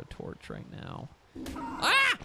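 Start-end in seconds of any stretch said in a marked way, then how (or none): 1.6-2.2 sudden high, loud cry of fright; startled yelp. Real, not acted. Gamer scared by a shark in the water, startled yelp. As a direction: jump at the shark. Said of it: "That startled me".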